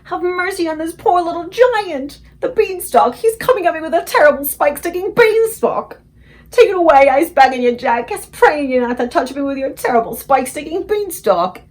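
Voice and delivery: high, loud, terrified pleading; desperate begging. A narrator's reading. Giant begging Jack for mercy, high loud terror. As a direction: beg for mercy at full volume.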